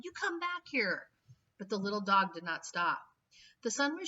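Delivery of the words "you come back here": high shouted order. A narrator's reading. Garbage king yelling after the dog. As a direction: yell after the dog, pitched up. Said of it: "Ernest shouted".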